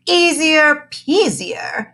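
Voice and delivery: high, slow, smug sing-song. Acted. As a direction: stretch it out, pleased with herself. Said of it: "in a voice a bit louder".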